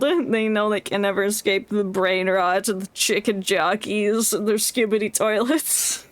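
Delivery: usual speaking voice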